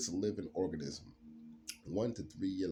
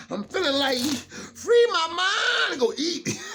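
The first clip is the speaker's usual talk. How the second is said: very high, slow, loud laughing voice; laughing, mock hungry; 14 dB louder, slower than usual. Joking to viewers about his hunger, high and laughing. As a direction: stretch it out while laughing.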